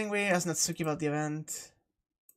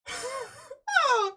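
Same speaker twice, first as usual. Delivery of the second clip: shaken, laughing, high-pitched yelps; scared horror game streamer. Real, not acted. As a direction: cry out in real fright. Reacting right after the jump scare.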